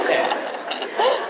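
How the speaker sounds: yeah said through loud laughter; laughing yeah. Real, not acted. Telling a funny story to the radio host, laughing through yeah. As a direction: still cracking up, high and loud. Still laughing after punchline.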